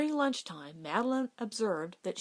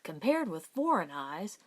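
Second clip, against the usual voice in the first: ordinary delivery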